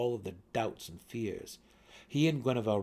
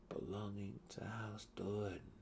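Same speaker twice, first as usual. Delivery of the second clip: "Belonging to House Do'Urden" much softer, whispered; 9 dB quieter than usual. A narrator's reading. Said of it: "Drizzt whispered aloud".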